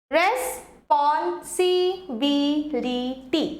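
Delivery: very slow, split into syllables. Shown as a demonstration. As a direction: break it into syllables for the class. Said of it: "দেখো".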